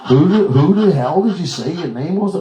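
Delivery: loud, high, baffled demand; incredulous. Retold or imitated. Director asking unknown actress her name, incredulous. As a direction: demand it loudly in mock disbelief.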